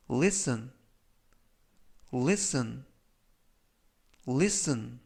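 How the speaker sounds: listen said as lisn, with no t sound; silent t. Shown as a demonstration. Teacher modeling listen with silent t for learners. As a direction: drop the t in listen. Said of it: "T가 묵음이라서".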